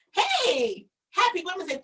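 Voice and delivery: bright shout-out to a live viewer; cheerful shout-out. Real, not acted. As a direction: greet them happily.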